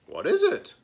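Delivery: put-on very high curious kid voice; a child squeaking a question; puzzled classmate. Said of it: "asked a classmate".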